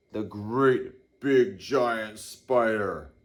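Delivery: slow it down like a big spider; giant spider voice. Shown as a demonstration. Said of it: "this kind of voice".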